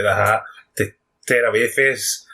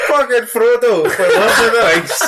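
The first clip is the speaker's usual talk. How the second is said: mock announcer intro; 8 semitones higher, 4 dB louder than usual. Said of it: "it's your Northern Irish voice".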